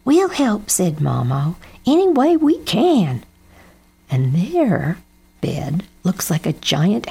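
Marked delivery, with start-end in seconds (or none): 0.1-0.7 higher-pitched, keen offer of help; eager character voice. A narrator's reading. Mama offers help, story dialogue. 1.9-3.2 higher-pitched, keen offer of help; eager character voice. A narrator's reading. Mama offers help, story dialogue.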